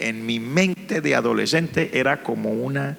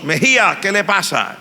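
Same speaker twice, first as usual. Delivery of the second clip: louder, higher call of a teacher; 6 semitones higher, 4 dB louder than usual. Retold or imitated. Teacher waking a crying student in class, loud call. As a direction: snap the student awake by name. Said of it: "que oí la voz".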